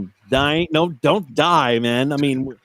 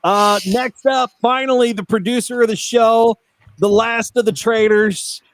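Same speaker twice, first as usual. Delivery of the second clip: announcer intro; 4 semitones higher than usual. Acted. Hyped show introduction of cohost.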